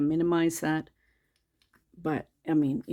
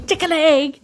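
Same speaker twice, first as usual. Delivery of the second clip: pet talk; 11 semitones higher, 10 dB louder than usual. Real, not acted. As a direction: coo at the dog, high and loud. Playing with the dog.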